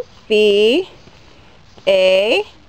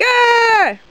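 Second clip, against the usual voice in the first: high mock shriek; playful scream; 11 semitones higher than usual. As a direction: squeal as if startled.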